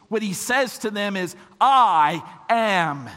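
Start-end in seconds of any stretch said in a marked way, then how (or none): 1.6-3.1 slow, loud I AM, each word separate; grand, emphatic self-revelation; divine declaration. Acted. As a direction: announce I AM with full weight. God revealing his name.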